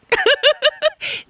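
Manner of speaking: very high, rapid giggle; quick tittering laugh. Real, not acted. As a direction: crack up at your own bit. Laughs at own bit.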